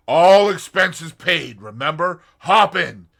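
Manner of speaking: loud, drawn-out boast; jaunty young man. A narrator's reading. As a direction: grin through the brag. Tommy voiced, cheerful.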